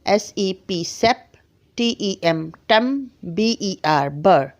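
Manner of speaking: "सेप", "टम", "बर" spelled then sounded slowly; syllable by syllable. Shown as a demonstration. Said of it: "जैसे मैं कहूँ".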